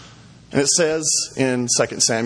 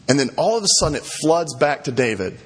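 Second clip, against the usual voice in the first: usual speaking voice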